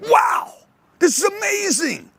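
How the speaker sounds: high, amazed outburst. Retold or imitated. Hearing the sales tape in his truck, amazed high gush.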